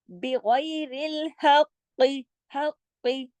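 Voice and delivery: "हक़्क़ि" with doubled heavy q; recitation demo. Shown as a demonstration. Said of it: "और तशदीद भी है".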